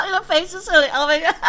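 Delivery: laughing, high and loud. Real, not acted. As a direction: crack up at the face. Laughing at the video's face.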